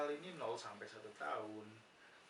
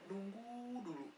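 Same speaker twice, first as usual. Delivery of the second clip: lightly voiced passive type, slow and higher; passive person; 8 semitones higher, slower than usual. Teacher illustrating passive people who wait to be told, lightly acted. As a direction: stretch it out as a mild waiting act. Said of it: "orang-orang yang pasif".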